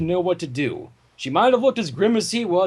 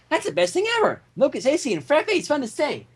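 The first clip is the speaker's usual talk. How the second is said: high, gushing and giddy; excited Pinkie Pie gushing; bubbly excited pony; 5 semitones higher than usual. Acted. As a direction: squeal it like a kid on sugar.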